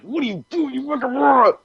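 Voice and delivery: high-pitched comic accusation; mock outrage. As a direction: squeak it out like a betrayed friend. Confronting friend who set him up.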